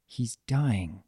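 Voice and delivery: soft, sad murmur. A narrator's reading. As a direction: break the news under your breath. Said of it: "as she murmured".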